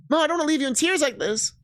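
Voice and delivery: high, urgent pleading; distressed plea. Acted. Partner begs not to leave Mel in tears, distressed.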